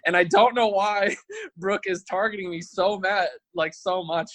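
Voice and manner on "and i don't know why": ordinary delivery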